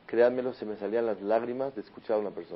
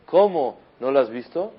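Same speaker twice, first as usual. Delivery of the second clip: angry father; 3 semitones higher, 7 dB louder than usual. Retold or imitated. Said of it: "se enfurece completamente y le dice".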